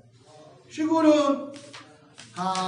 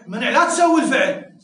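loud, higher mock command not to act; acted prohibition; 4 semitones higher, 9 dB louder than usual. Shown as a demonstration. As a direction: forbid it forcefully, as a demonstration. Teacher voices a prohibition.

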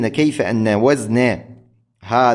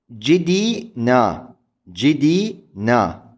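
very slow جدينا drawn out; slow scansion; much slower than usual. Shown as a demonstration. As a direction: stretch جدينا to show فعول. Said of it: "على وزن فعول". (نطق جدينا ببطء لبيان الوزن)

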